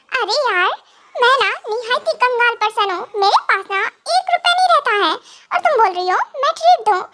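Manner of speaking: normal talking voice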